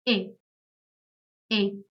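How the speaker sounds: e said as "eh"; German letter E. Shown as a demonstration. Teacher showing learners the German alphabet, e as "eh". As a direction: give e its German sound, "eh". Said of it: "Letter E is pronounced as".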